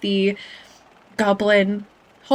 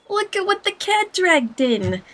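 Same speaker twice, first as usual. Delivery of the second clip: higher-pitched goblin greeting; raised, animated goblin character voice; 10 semitones higher than usual. Acted. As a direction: play a goblin scolding an overdue friend. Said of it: "my best goblin voice".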